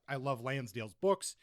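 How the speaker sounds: normal talking voice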